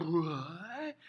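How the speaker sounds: drawn-out, rising mock disbelief; incredulous reaction. Teacher imitates students seeing the board, incredulous. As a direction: act out a baffled student, voice sliding upward. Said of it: "you're gonna be like".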